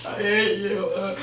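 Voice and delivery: whimpered imitation of a crybaby; mock crying. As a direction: blubber it in mock misery. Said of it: "And he's all crying".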